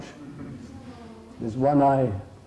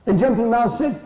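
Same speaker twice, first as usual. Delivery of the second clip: usual speaking voice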